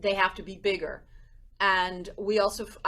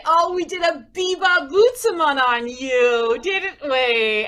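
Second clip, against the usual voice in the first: playful triumph; 6 semitones higher, 8 dB louder than usual. Retold or imitated. Gleefully telling mom the prank.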